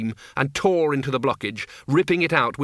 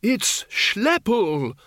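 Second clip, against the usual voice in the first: high, drawn-out excited shout; 9 semitones higher, much slower than usual. A narrator's reading. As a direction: cry it out high and slow. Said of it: "shouted Windle".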